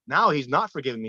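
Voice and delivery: ordinary talk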